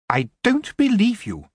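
cold, imperious queen voice, pitched high; haughty elf queen. A narrator's reading. Queen to goblin prisoner, imperious and cold. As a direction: speak down to him like a queen.

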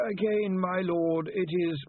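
high, slow, patient lawyer voice; sighing lawyer. A narrator's reading. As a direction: begin the advice slowly, as after a sigh. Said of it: "who gave a little sigh".

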